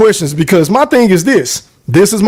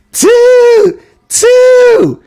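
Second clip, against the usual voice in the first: high, held train-whistle hoot; imitated train whistle; 13 semitones higher, 3 dB louder than usual. Likening a boxer to a locomotive, high imitated whistle. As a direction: toot like a locomotive. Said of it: "is like a locomotive".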